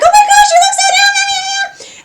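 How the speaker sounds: very high, fast squealing tease; teasing squeal. Retold or imitated. Charlie teasing Pentious in a very high, fast squeal. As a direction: squeal it out, mocking him. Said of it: "Charlie's just like".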